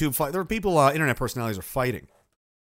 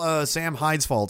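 ordinary talk, nothing marked

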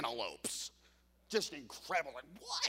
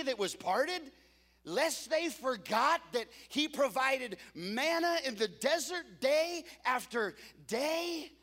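louder, rising preacher cadence, slowing at the end; full-force preaching; 6 semitones higher, 5 dB louder than usual. Acted. Pastor reminding the church of God's miracles, full-force preaching.

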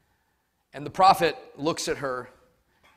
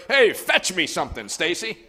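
too casual demand; 4 semitones higher, 6 dB louder than usual. Retold or imitated. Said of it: "Sounds just way too casual".

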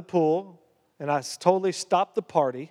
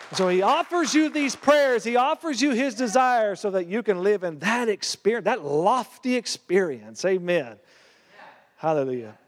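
high, ringing sermon voice; preaching; 4 semitones higher than usual. Acted. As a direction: proclaim it to the congregation. Preaching continues.

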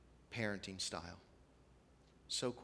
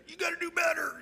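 much higher, loud mock yell; mock screaming parent; 4 dB louder than usual. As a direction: yell like a crazed sideline parent.

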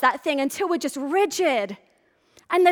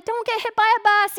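mild mock yell; 6 semitones higher, faster than usual. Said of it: "I'd be yelling".

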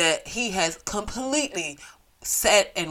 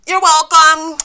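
loud, high mock-gracious thanks; sarcastic you're welcome after cutting the show short; 9 semitones higher, 13 dB louder, slower than usual. Real, not acted. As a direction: toss out a smug you're welcome.

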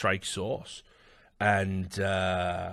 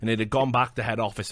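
ordinary talk, nothing marked

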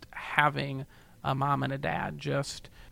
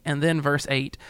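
ordinary delivery

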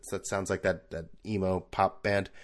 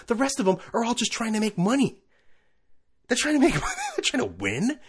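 mock greedy owner; 11 semitones higher than usual. Mocking team owners' greed.

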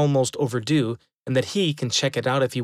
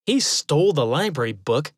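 ordinary delivery

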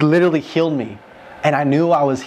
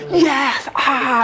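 mock joyful scream; 10 semitones higher than usual. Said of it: "screaming on top of their lungs".

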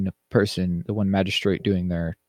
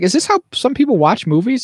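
much higher and louder, disbelieving; high disbelieving outcry at moviegoers; incredulous; 13 semitones higher, 6 dB louder than usual. Retold or imitated. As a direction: ask it in outraged disbelief. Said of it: "'Cause I'm like".

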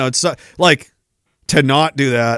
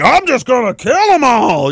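higher shouted mock rampage; mock killer; 7 semitones higher than usual. Mocking the commenter's reply, shouted higher. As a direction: blurt it like a gleeful maniac. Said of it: "And you're like".

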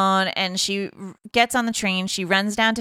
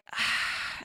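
soft breathy put-on sigh; exasperated sigh; 11 dB quieter than usual. Drew reacts to Pete's odd phone call, with a baffled sigh.

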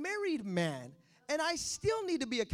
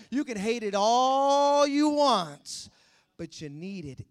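ordinary delivery

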